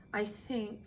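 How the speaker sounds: put-on soft, timid little girl; girl timidly objecting to the clerk; small voice. A narrator's reading. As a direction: say it hesitantly, barely heard. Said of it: "her second grade voice didn't sound very strong".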